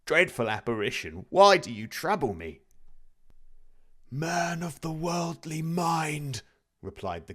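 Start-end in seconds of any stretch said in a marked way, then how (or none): 0.0-2.6 terrified plea. A narrator's reading. Scrooge on his knees begging the apparition, terrified and loud. 4.1-6.4 slow, high, hollow ghostly drawl. A narrator's reading. The dead partner's ghost answering Scrooge, slow and hollow.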